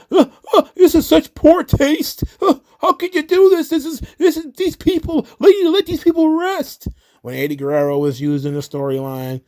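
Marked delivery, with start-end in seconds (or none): 0.1-6.9 very high, whiny mocking voice; whiny mock fan. Vlogger mocking fans defending the storyline, high whiny parody. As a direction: squeak it out like an outraged fan.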